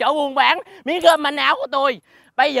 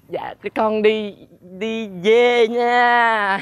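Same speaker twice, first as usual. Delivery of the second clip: slow, stretched-out excuse; drawling, caught-out goodbye; sheepish exit; 2 semitones lower, much slower than usual. Acted. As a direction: drag the words out while edging away. Caught, tries to slip away.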